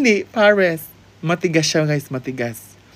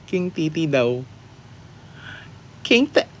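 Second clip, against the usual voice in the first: normal talking voice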